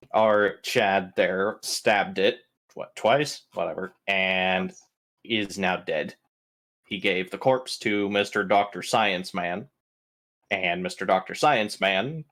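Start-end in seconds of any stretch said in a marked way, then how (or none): none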